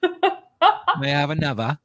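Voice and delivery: high, slow Cockney urchin plea; Cockney accent. Pretend. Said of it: "my Cockney accent".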